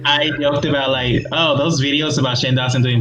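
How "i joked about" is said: ordinary talk, nothing marked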